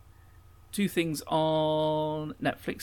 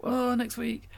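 moaning; 9 semitones higher, slower than usual. Pretend. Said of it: "moan about it".

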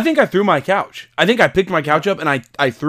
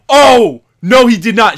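loud, high reenacted gasp of denial; hammed-up stunned outburst; exclaimed disbelief; 7 semitones higher, 10 dB louder, slower than usual. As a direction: cry out as if you can't believe it. Reenacting his hyped viewing reaction.

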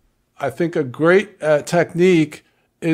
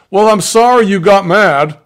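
a louder, snippy fake sorry; mock grudging apology; 3 semitones higher, 8 dB louder than usual. Mocking someone who apologizes badly, hammed-up and snippy. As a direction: sneer out the non-apology. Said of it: "That's worse than not apologizing".

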